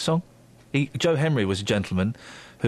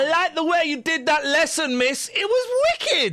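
excited pupil; 14 semitones higher than usual. Acted. Pupil praising the teacher's lesson, put-on high voice. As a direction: gush in a much higher pupil's voice.

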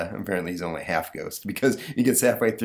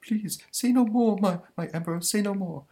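pleading monster; 12 semitones higher than usual. Acted. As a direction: beg nervously in a cartoon monster voice. Monster begs Napoleon's silence.

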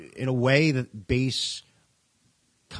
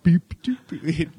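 higher, louder playful trumpet noises; mock trumpet beeps; 6 semitones higher, 5 dB louder, slower than usual. Joking about pressing trumpet buttons, mock trumpet beeps. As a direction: beep it like a toy trumpet. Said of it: "imitating trumpet sounds".

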